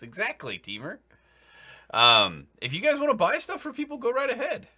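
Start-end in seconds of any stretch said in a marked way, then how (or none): 2.6-4.7 exasperated. Real, not acted. GM exasperated, high and fast.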